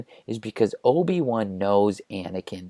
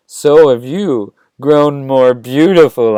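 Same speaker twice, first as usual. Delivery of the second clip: slow, loud, overdone flirting; awkward flirting; 2 semitones higher, 7 dB louder, slower than usual. Acted. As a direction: lay the clumsy compliment on thick, slowly. Anakin awkwardly flirts with Padme.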